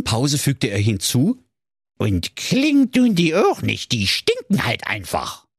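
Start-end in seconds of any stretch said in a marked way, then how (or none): 2.0-5.5 grumpy dwarf. Acted. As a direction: drag it out sulkily, like a surly dwarf. Dwarf adds after a pause.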